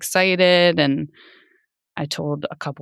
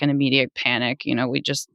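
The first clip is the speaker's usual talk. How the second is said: ordinary delivery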